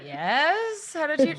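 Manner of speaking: high, loud, baffled mock reply; retold freaked-out reply. Customer answering uncannily accurate store woman, retold freaked-out surprise. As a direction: act out being spooked by a stranger's guess. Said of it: "And I was so freaked out".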